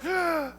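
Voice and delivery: high, soft, feeble mock roar; tiny cub roar. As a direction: squeak out a little cub's attempt at roaring. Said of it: "I'm gonna roar, I'm gonna scare the enemy".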